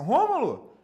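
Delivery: "Rômulo!" shouted out high and loud; energetic greeting. Shown as a demonstration. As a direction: throw the name up with energy and lift the call. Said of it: "com essa entonação". ("Rômulo!" bem alto e animado, lá pra cima)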